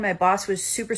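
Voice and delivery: normal talking voice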